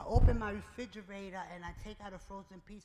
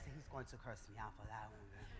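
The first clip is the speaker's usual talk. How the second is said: much softer, laughing through the words; 10 semitones lower, 13 dB quieter, faster than usual. Real, not acted. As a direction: chuckle it out under your breath. Laughing about the director.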